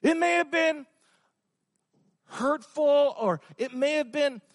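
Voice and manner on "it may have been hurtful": ordinary talk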